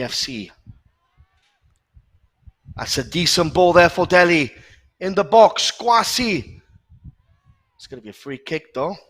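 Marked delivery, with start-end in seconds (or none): 2.7-6.6 excited commentary. Real, not acted. Attack builds into the box.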